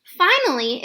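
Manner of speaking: usual speaking voice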